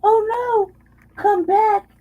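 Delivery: high, loud mock whine; hammed-up high begging; mock pleading. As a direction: plead like they suddenly miss you. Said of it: "they're like".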